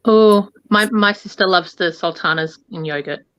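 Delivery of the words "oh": ordinary talk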